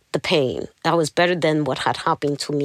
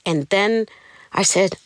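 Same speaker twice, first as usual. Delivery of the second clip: usual speaking voice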